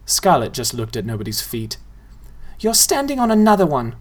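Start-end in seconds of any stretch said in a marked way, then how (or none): 2.6-3.9 put-on higher girlish voice, teasing; girl's voice. A narrator's reading. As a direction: point it out like a smug little girl. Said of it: "she said".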